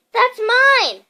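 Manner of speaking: high, loud protest; indignant protest. A narrator's reading. Harry protests to Uncle Vernon, high and loud. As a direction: cry out that it's yours.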